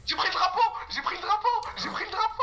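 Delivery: high, rising triumphant cries; excited yelling. Real, not acted. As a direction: shout it out, thrilled. Grabs flag in game, excited. (cri excité)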